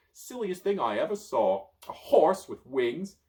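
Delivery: loud, slow ridicule; mocking wizard. Merlin ridiculing Pegasus, slow and loud. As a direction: laugh down the winged horse.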